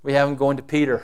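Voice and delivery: normal talking voice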